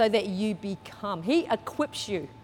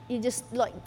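usual speaking voice

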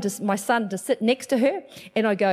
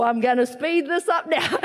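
usual speaking voice

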